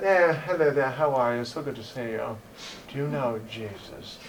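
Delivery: soft, quiet put-on British accent; mock-polite British-accented greeting. Evangelist greeting a stranger in a quiet put-on British accent. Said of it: "what's with the British accent either".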